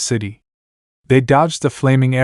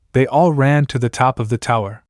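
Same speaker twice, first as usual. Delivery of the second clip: ordinary delivery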